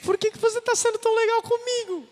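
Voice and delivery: chorando. Retold or imitated. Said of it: "Ela começa a chorar".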